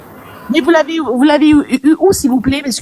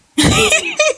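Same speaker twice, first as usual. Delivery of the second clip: éclat de rire aigu et strident; elle éclate d'un rire très aigu. Real, not acted. (rire aigu qui part en éclat)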